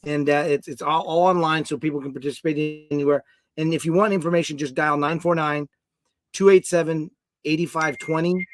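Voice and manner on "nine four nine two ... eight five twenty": the number read slowly, digit groups spaced out; careful, deliberate phone number read; slow dictation. Shown as a demonstration. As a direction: dictate it slowly so people can write it down. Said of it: "and text the word".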